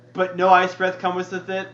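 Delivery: ordinary delivery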